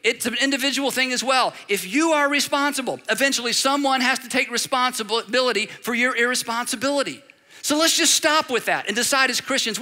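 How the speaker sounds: usual speaking voice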